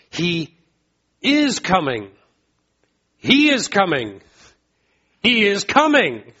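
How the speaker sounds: rising preacher's cry; building proclamation. Real, not acted. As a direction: build it up, ringing out "He is coming".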